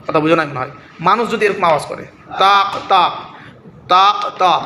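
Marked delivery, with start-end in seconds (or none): 2.4-4.6 two sharp knocks like stones striking; stone click imitation. Shown as a demonstration. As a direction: clack it out like stones hitting, taak taak. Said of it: "মানে দুইটি পাথরের সংস্পর্শে". (পাথরে পাথরে ঠোকার আওয়াজের নকল)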